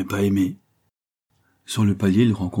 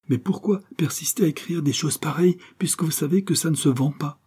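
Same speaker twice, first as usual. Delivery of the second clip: pressing, higher-pitched challenge; relentless questioning; 9 semitones higher than usual. A narrator's reading. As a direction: press the point without letting up. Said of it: "poursuivait-elle inexorablement". (insistance inexorable)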